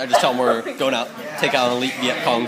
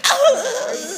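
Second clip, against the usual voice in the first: very high mock howl of pain; hammed-up high squeal; pained yelp. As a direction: yelp as if just kicked hard. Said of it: "You just kick him in the balls".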